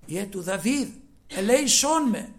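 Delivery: loud, pleading cry rising up high; retold cry for mercy. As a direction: cry out to Jesus, begging. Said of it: "Και φώναζε δυνατά". (δυνατή ικετευτική κραυγή)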